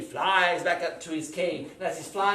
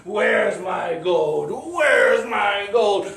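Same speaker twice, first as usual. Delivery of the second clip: loud, slow shout, rising higher on the repeat; angry dragon; 4 semitones higher, 13 dB louder, much slower than usual. Acted. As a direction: bellow each word as a raging dragon. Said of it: "he was angry".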